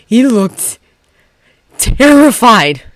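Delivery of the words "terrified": ordinary delivery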